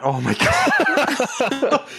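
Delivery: high, drawn-out wail breaking into laughter; mortified, laughing high-pitched groan; embarrassed laughter. Real, not acted. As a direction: laugh helplessly at your own shame. Embarrassed by own drunk story.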